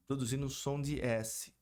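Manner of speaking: ordinary talk, nothing marked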